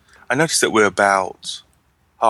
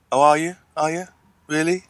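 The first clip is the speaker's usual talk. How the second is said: mock sarcasm; 8 semitones higher than usual. Sarcastic reply to own character.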